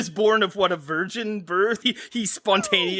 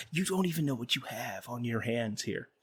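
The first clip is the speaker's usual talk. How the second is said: far softer, lower hushed voice; hushed awe; 8 semitones lower, 11 dB quieter than usual. Pretend. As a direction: whisper it like a grave secret. Hushed awed movie line.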